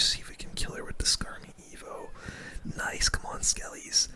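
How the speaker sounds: ordinary delivery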